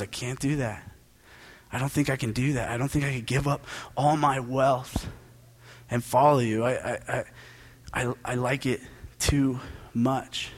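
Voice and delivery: low, quiet and dejected, halting over the words; disheartened. Acted. Rich man turning down Jesus's call to give up his wealth, disheartened. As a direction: give up softly, stumbling on the I's. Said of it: "it says that he's disheartened".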